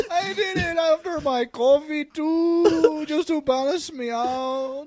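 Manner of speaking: slow, high mock whimper easing down; crying. Suspect explaining himself to police, mock crying. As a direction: whine it out through fake tears. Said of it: "He just started crying".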